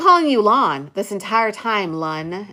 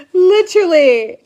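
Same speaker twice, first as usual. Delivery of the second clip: mock outrage; 7 semitones higher, 7 dB louder than usual. Real, not acted.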